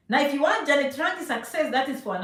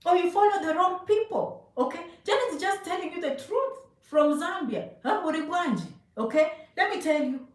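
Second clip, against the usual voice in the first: usual speaking voice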